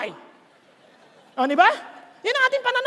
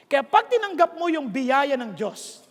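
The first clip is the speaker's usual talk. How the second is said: ordinary delivery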